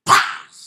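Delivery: sudden very loud bang noise; explosive burst imitating shattering glass; imitated glass explosion. Preacher imitating the glass blowing up, loud bang. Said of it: "itu gelas bisa meledak".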